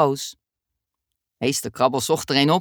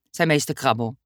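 ordinary delivery

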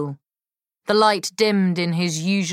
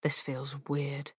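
whispering; 3 semitones lower, 13 dB quieter than usual. Acted. Whispered line opening the scene.

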